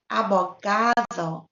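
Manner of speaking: "abogado" with a long GA; stress on second-to-last syllable. Shown as a demonstration. Teacher giving an example to students, "abogado" stressed on GA. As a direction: lean on GA in "abogado". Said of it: "stressed on the second to last syllable".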